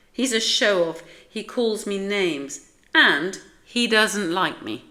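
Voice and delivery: loud, emphatic list of grievances; put-out, childlike protest; indignant complaint. A narrator's reading. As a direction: pile up each grievance with growing force. Splat complains about Spike.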